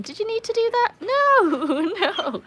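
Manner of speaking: high-pitched, giggly telling-off of the dog; laughing protest. Real, not acted. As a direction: protest through real laughter, voice shooting up. Dog has just soaked her.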